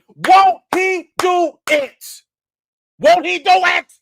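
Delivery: slow, loud, high church shout; praise shout. Real, not acted. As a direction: shout it to the rafters.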